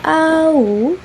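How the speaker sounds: "au" held long and high; demonstrated diphthong. Shown as a demonstration. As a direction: stretch "au" from ah into oo. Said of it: "the second diphthong is".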